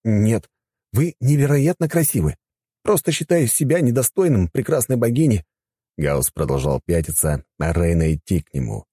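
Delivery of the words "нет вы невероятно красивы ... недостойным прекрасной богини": put-on lightly higher, courteous young man; polite young man voice. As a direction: speak as a respectful young god. Gauss declines politely. (вежливый молодой мужчина)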